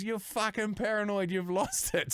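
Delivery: high-pitched retold accusation; mock exasperation. Acted quarrel between the flatmates.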